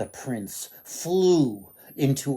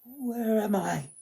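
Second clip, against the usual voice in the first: sighing; 9 semitones higher than usual. Acted. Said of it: "she sighed".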